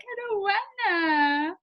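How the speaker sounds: high, very slow whimpering wail; dinosaur whine. Retold or imitated. Couple answering each other, dinosaur whine. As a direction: answer like the whining dinosaur kid. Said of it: "We're like".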